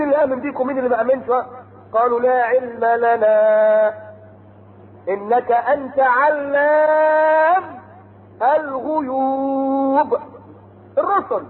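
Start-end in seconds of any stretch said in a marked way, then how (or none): 2.2-10.2 very slow, stretched melodic chant; Quran recitation. Acted. Preacher chanting the messengers' answer to God. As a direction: hold each vowel long like a reciter.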